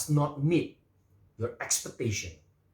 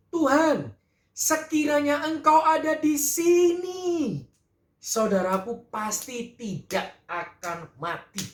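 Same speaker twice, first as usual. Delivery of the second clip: much higher, slow, anguished plea; Marta's lament; 11 semitones higher, 4 dB louder, much slower than usual. Acted. Marta at the gate reproaching Jesus, high drawn-out lament. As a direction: cry it out to the Lord as grieving Marta. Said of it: "Dikatakan di ayat dua puluh satu".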